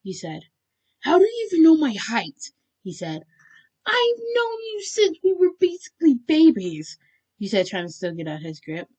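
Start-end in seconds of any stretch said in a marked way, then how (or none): 1.0-2.5 high, loud, incredulous demand; indignant question. A narrator's reading. Bakugo bickering with his captive, indignant. Acted bickering between the two. 3.9-7.0 very high, loud exasperated retort; exasperated shout. A narrator's reading. Listener struggling out of his grip, exasperated.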